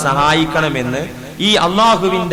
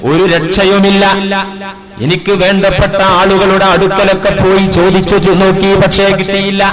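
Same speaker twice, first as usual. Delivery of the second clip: loud, ringing sermon voice; preaching at full force; 3 semitones higher, 6 dB louder than usual. Acted. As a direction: proclaim the story to the hall. Friend's plea in preaching voice. (ഉച്ചത്തിലുള്ള പ്രസംഗ ശൈലി)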